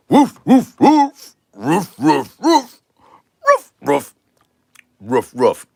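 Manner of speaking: high, loud woofs in short bursts; imitated dog bark. Comic asked to tell your dog a joke, imitated dog bark. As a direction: bark out a run of high ruffs.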